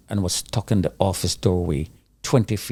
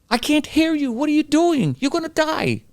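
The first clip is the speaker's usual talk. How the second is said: retold frantic yell. Rescuer warns trapped man he will die, high imitated yell. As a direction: imitate yelling through a wall, pitch way up. Rescuer yelling through the wall.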